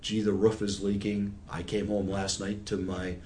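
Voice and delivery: ordinary talk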